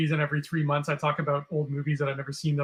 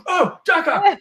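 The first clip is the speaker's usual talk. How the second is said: high, louder surprised recognition; delighted recognition; 8 dB louder, slower than usual. Retold or imitated. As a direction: spot the name in the credits with glee. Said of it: "I'm like".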